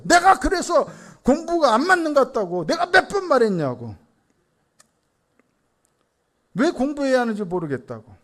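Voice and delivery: imitated daughter arguing back, high and loud at first; hammed-up indignant comeback easing off at the end; argumentative child. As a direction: argue back at dad like a fed-up kid. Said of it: "막 저한테 막 따져요".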